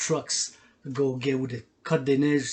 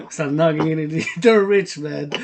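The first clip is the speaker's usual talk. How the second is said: louder, laughing through the words; loud laughing taunt; 3 semitones higher, 9 dB louder than usual. Real, not acted. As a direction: laugh while mocking the rich rappers. Mocking rich rappers while laughing.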